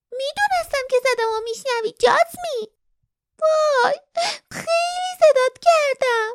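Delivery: put-on high young girl's voice; young sweet voice. A narrator's reading. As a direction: speak like a young girl longing. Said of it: "صدایی جوان، شیرین و گرم".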